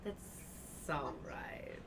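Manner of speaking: very slow unvoiced whisper; breathy whisper. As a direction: breathe it out slowly. Character answers snub.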